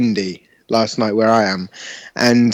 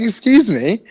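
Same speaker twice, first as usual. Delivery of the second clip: indignant high excuse me; 7 semitones higher than usual. Retold or imitated. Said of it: "I'm like".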